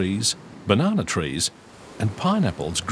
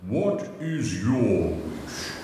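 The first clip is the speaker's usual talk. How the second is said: slow, booming genie voice; grand slow magical giant; much slower than usual. A narrator's reading. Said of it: "he asked".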